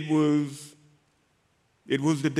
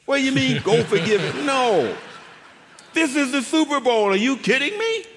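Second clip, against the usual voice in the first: incredulous protest; 7 semitones higher, 3 dB louder than usual. Retold or imitated. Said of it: "And I'm like".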